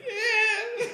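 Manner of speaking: high, laughing, almost crying yeah. Retold or imitated. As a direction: squeak it out through the laughter. Laughing too hard to answer.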